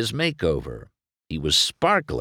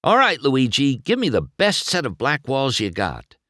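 ordering; 7 semitones higher than usual. A narrator's reading. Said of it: "McQueen ordered".

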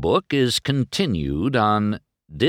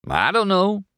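admitting Mater; 12 semitones higher, 3 dB louder than usual. A narrator's reading. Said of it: "Mater admitted".

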